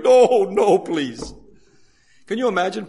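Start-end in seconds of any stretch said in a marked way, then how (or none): 0.0-1.3 loud high no, then a small please; pleading Lazarus. Retold or imitated. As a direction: beg not to be called back. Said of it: "Lazarus up there going".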